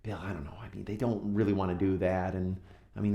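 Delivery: hesitant, hushed private aside; confidential. Retold or imitated. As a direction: voice a wife quietly confiding her doubts. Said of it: "talked with me secretly".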